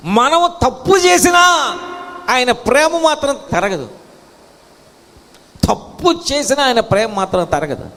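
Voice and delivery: high preaching shout easing slightly; impassioned proclamation. Real, not acted. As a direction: cry it out to the congregation. Preacher proclaims unfailing love.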